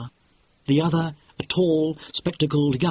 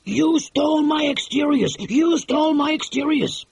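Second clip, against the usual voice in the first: high, louder mock holler; retold furious holler; 12 semitones higher, 4 dB louder than usual. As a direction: shout it like an outraged rival. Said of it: "jump up and down and holler".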